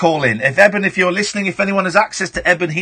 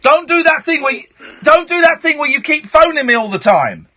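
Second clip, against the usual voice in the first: high, raised-voice scolding; insistent, overriding rant; exasperated telling-off; 7 semitones higher than usual. Real, not acted. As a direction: lay down the law to a nuisance caller. Host tells off caller.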